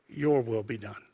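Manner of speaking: low, soft imitation of yielding prayer; quiet surrender. Jesus yielding to the Father after protest, in quiet surrender. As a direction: drop pitch and volume, give in.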